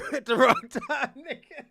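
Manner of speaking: punchline rising into loud laughter; laughing. Real, not acted. Joking to friends about bad timing, laughing. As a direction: lose it laughing at your own punchline. Cracking up over cousin's story.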